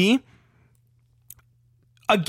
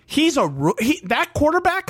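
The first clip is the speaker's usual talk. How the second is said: usual speaking voice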